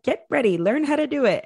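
high, pushy instructions; bossy cousin. Retold or imitated. Cousin telling her to learn it, high and pushy. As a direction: push her to get ready, high.

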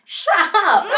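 high, loud, laughing dismissal; laughing shut up. Retold or imitated. To nosy followers, a laughing high shut up. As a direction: brush the critic off with a laugh. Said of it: "Then I'm like".

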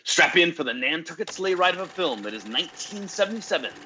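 dramatic trailer narration; movie trailer voice. Acted. Movie trailer style intro.

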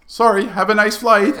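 high, loud mock-cheery send-off; breezy brush-off. Major leaves the new lieutenant stuck, breezily cheerful. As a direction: play the major cheerfully washing his hands.

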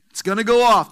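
loud, emphatic prophecy; booming warning. Acted. God warns of the coming quake, booming. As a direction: proclaim it with force.

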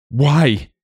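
sharp high anguished scream; high desperate cry. Acted. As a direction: scream it in despair at the old man. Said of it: "he screamed".

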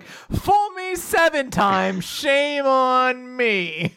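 slow smug quip. Retold or imitated. Kusanagi's smug retort.

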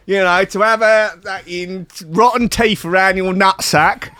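hammed-up Cockney mate telling him to buck up; mock Cockney lad. Cockney friend urging his mate to liven up, brash. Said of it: "Cockney Jesus".

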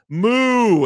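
loud, high mock cow moo. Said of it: "I don't know how to make this sound".

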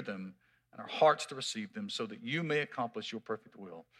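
hushed, prayerful opening prayer. Acted. Pastor praying before the sermon, hushed and reverent. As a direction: softly lead the church in prayer.

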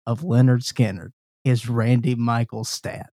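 mock concert announcer intro. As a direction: introduce the band like an emcee. Hosts a concert-style introduction.

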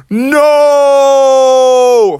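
long, loud, high cry of dismay; high drawn-out wail; Bubby Bear. Acted. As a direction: stretch out a big dramatic howl. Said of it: "Bubby Bear was like".

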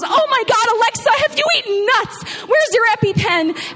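high, frantic alarmed cry; freaking out. Retold or imitated. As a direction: panic over a friend's allergy. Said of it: "And she's freaking out".

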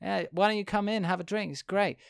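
high, casual welcoming host; a hint of a chilled-out rich guy offering a drink; lightly voiced party host. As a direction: invite him in lazily. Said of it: "a man who's just like".

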